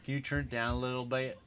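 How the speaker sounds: soft, sweet Southern accent; polite southern accent. Real, not acted. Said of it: "I'll talk to Chanel in a southern accent when I wanna be polite".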